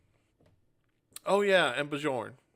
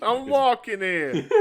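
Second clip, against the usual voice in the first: put-on slow, loud, high New York accent through laughter; laughing mock New York accent; 9 semitones higher, 6 dB louder, slower than usual. Replaying the walking-here joke, laughing, in a mock New York accent. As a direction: crack up mid-line like a New Yorker in a mock New York accent.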